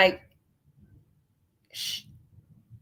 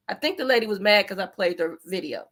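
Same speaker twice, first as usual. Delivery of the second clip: usual speaking voice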